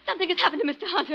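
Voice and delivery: high, rushed, alarmed; urgent alarm. Acted. Wife tells servant her husband is stricken, rushed alarm. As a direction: blurt out the bad news fast.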